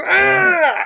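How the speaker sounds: very high, loud scream; victim's scream. Acted. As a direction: scream in agony as the arm is seized. Acting out the struggling victim.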